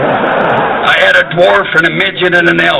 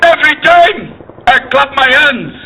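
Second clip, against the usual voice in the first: [name]; 9 semitones higher than usual. Acted. [name] clapping to a hushed Scottish crowd, solemn. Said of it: "And he said".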